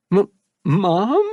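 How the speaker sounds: put-on high, stammered, disbelieving boy; stuttering. A narrator's reading. Son stammers at his mother's voice, stuttering. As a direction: stumble over the first sound in shock. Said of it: "He stuttered".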